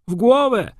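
high, loud cry; krzyk. A narrator's reading. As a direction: call it out sharply from the crowd. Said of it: "krzyknął ktoś". (podniesiony, głośny okrzyk)

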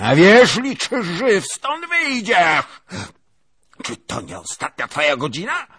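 very high, loud menacing scream; shrieking threat. Acted. Princess shrieks death threats at the lieutenant. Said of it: "wołała kniaginia".

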